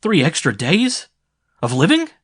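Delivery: high, loud disbelieving question; can't believe what he hears; stunned incredulous question; astonished. Acted. Sim stunned by the offer.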